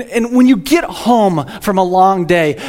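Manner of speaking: normal talking voice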